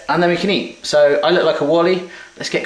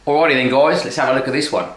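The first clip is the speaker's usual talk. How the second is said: put-on a light go at an Australian accent. Cook shows viewers the dish, lightly mocked-up Australian accent. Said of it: "That's my best Australian accent".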